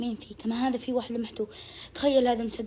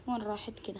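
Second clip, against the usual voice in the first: usual speaking voice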